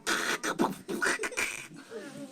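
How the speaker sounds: high breathy grinding sound; sputtering motor noise. Acted. Blender's shot motor trying to start, grinding noise. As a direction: imitate a broken motor whirring.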